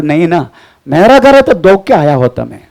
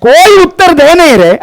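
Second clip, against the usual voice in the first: high, loud preacher echo; preaching repeat; 7 semitones higher, 3 dB louder than usual. Acted. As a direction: echo the verse with force. Preacher repeats reading loudly. (ऊँची आवाज़ में प्रचारक की तरह दोहराना)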